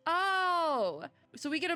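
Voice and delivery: loud, high drawn-out oh; surprised realization. Real, not acted. Player learning there is a message from relatives, surprised. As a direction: let the sudden realization ring out.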